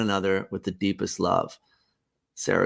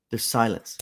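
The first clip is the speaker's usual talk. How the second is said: ordinary delivery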